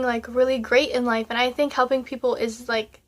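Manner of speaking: ordinary talk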